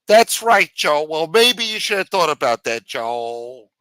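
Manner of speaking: high, pleading whine at the boss; whiny jailed underling. Acted. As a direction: complain like a small-time crook who got pinched. Underling retorts.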